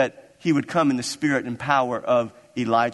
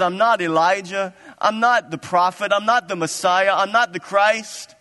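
emphatic denial; 6 semitones higher, 4 dB louder than usual. Acted. Preacher voicing John's emphatic denials.

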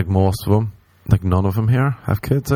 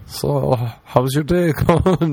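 high, drawn-out chirpy greeting breaking into a laugh; mock small talk; 5 semitones higher, much slower than usual. Hungover man attempting small talk with a little kid, mock chirpy. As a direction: overdo the friendly adult talking to a kid.

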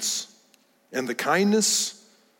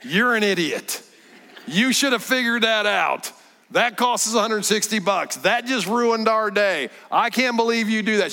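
higher mock scolding, a wife venting at her husband; pretend scolding wife; 6 semitones higher than usual. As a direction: scold like a frustrated wife, half in jest. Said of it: "She had every right to go".

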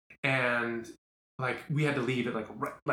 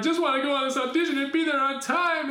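high, louder, rushing overwhelm; upset; 12 semitones higher, 5 dB louder than usual. Real, not acted. Retelling the stressful morning, high and overwhelmed. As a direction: pour it out like it's all too much. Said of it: "I'm upset".

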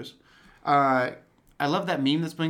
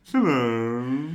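louder, lordly villain hello; Dooku greeting; 5 dB louder than usual. Retold or imitated. Imitating Dooku's hello for a co-host. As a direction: greet them like a smug count. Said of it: "Dooku impression continues".

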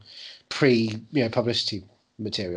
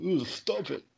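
soft, higher-pitched "ooh, stop it"; mock feeble protest; 4 semitones higher, 6 dB quieter, slower than usual. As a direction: send up a toothless fighter, hushed and prissy. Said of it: "He just goes out there and goes".

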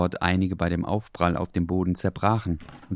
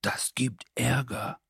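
soft, slow, higher worried voice; whispering; 5 semitones higher, 6 dB quieter, slower than usual. A narrator's reading. Leo warns Dackel about trouble, softly and slowly. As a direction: hush it, scared of getting caught. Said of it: "flüsterte Leo plötzlich".

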